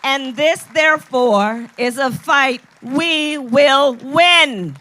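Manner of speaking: loud, slow, rousing stump speech climax; rally speech. Acted. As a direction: build to a booming finish for the crowd.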